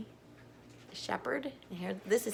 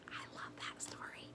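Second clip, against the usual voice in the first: whisper; 13 dB quieter than usual. Real, not acted. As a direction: lean in and whisper it. Hushed aside after the story.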